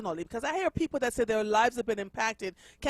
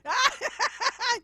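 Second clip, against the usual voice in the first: high laugh; 13 semitones higher than usual. Real, not acted. Host laughs at own accent slip.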